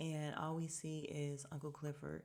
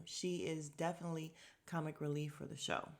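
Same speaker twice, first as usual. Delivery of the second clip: ordinary talk, nothing marked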